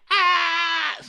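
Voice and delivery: very high imitated shriek; mock scream. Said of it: "I'm gonna scream too".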